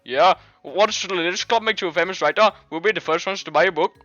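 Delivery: badly faked Indian accent. Friend teasing about becoming a writer, in a fake Indian accent. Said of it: "fucking Indian man's voice instead".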